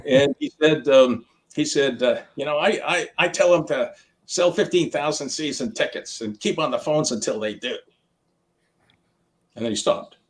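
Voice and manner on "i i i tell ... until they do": a lightly imitated Chicago accent, a bit higher; the owner's no-nonsense advice in a light Chicago accent. Wirtz telling Bruce his secret, light Chicago accent. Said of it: "in that Chicago Blackhawks accent of his".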